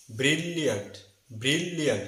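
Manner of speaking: ব্রিলিয়ান্ট with long doubled L, bril-liant; correct pronunciation. Shown as a demonstration. Teacher repeating for learners, held L in bril-liant. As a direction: linger on the L in ব্রিলিয়ান্ট. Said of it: "সঠিক উচ্চারণ হবে".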